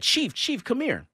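high-pitched retold call; calling someone over. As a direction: imitate her urgently summoning the chief. Retold calling over police chief.

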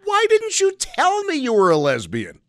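high, loud put-on voice; mock shocked voice. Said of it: "trying to be funny".

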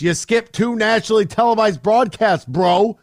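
loud, high, fired-up rant; heated rant. Real, not acted. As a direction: let it all out at him. Ranting at LeBron's antics.

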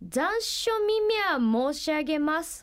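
slow, careful letter reading; reading a letter aloud. Real, not acted. Host reads a listener's card to the guest, slowly. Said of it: "お便り届いてんで読んでいいですか？".

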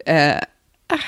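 ordinary talk, nothing marked

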